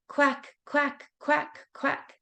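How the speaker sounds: high quacking; duck quacking. Pretend. Duck sound in kids' rhyme.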